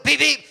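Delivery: high loud beep beep; imitated horn. Driver honking at an imaginary car, loud imitated horn. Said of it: "turns the wheel".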